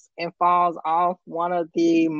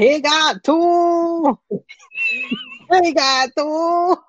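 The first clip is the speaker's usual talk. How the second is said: put-on high, slow Arnold accent; Arnold Schwarzenegger impression; 11 semitones higher, slower than usual. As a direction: do Arnold shouting it out. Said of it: "my Arnold's impression and yours".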